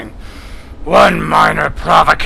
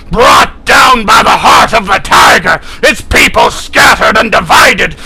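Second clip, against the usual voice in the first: loud, much higher defiant proclamation; proud furious shout; 6 semitones higher, 7 dB louder than usual. Acted. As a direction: roar it like a battle cry. Kilrathi proclaims his people's endurance.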